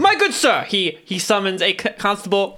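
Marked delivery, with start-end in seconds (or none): 0.0-0.6 high, loud courtly address; courtly knight. Acted. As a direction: gallant noble flourish. Paladin NPC, courtly.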